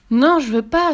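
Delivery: put-on loud, high child's refusal; disgusted cry. A narrator's reading. Pierre refusing to become witches' soup, disgusted cry. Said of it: "s'écria Pierre dégoûté".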